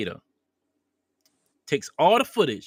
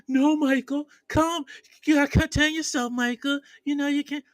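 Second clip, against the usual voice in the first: imitating her in a much higher pleading voice; high woman's voice; 12 semitones higher than usual. Retold woman begging the monster to calm down, high pleading voice. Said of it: "And she's like".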